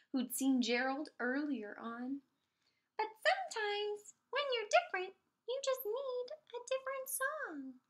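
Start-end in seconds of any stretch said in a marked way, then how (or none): 3.0-7.8 higher little cricket voice, gently; a tiny cricket giving kind advice. Acted. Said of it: "he said".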